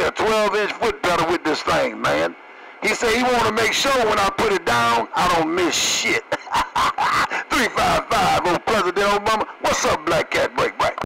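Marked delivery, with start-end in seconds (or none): 6.3-7.5 airy cackle of laughter. Real, not acted. Trucker cracking up on the radio after his punchline. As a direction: break up laughing.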